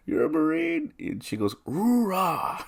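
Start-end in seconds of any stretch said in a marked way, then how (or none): 0.1-0.9 very high, loud imitated disbelief; astonished grandfather. Grandfather learns nurse is a Marine, high astonishment. 1.7-2.6 high, loud imitated hoorah; Marine war cry. Nurse answers as fellow Marine, loud high cry.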